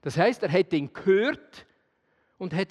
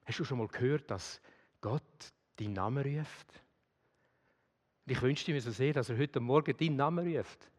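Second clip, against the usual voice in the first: softer, lower, personal preaching voice; soft intimate appeal; 5 semitones lower, 5 dB quieter than usual. Acted. Preacher softly asking the congregation to answer God. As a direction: draw the listener in quietly.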